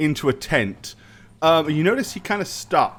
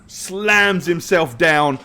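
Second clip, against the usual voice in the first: ordinary delivery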